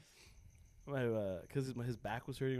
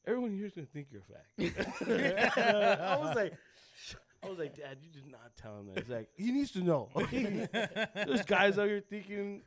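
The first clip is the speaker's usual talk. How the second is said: laughing; 6 semitones higher, 4 dB louder than usual. Real, not acted. Laughing while quoting dad.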